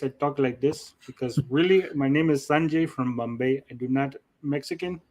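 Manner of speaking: hammed-up Indian accent; joking impression of an Indian accent; put-on Indian accent. Shown as a demonstration. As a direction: introduce yourself in a fake Indian accent. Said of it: "when I put my real voice".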